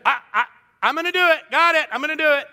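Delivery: put-on a kid caught out promising to do it; flustered kid stalling. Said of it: "You're like".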